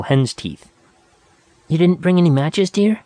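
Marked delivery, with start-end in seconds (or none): 1.7-3.0 put-on young woman voice. A narrator's reading. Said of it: "Nada speaking".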